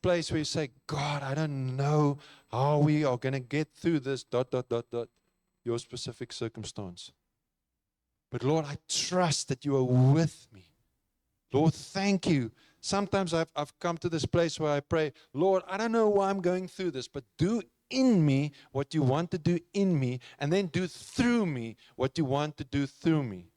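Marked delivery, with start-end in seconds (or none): 0.9-12.5 earnest spoken prayer, rising at the close; heartfelt prayer. Pretend. Voices an example prayer. 15.3-23.5 fervent prayer, pitched up and pushed; surrendering prayer with force; earnest prayer. Retold or imitated. Voices his own prayer.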